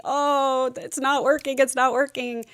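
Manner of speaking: husband faking pain after surgery, whiny; mock whining. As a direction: whine like a faking patient.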